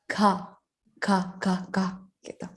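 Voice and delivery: "ka" with a soft burst of air, repeated; aspirated ka. Shown as a demonstration. Teacher showing students the Korean sound "ka". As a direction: breathe out on each "ka", Korean style. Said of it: "dibacanya itu ka".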